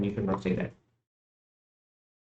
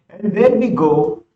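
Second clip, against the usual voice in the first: excited; 7 semitones higher, 5 dB louder than usual. Real, not acted. Fix works at last.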